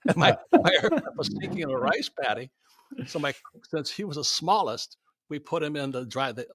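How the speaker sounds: ordinary talk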